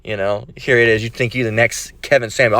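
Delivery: normal talking voice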